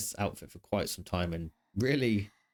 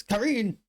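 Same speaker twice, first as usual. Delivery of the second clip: high, loud squeaked name; nervous squeal; 12 semitones higher, 5 dB louder than usual. Pretend. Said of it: "a noise I make sometimes when I'm nervous".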